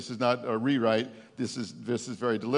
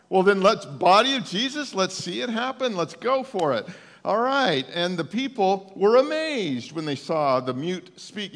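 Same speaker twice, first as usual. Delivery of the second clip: preaching louder and higher, urging the church on; rising preaching; 5 semitones higher, 4 dB louder than usual. Acted. Pastor rallying his church at full preaching force. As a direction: lift the room, call them to act like Jesus.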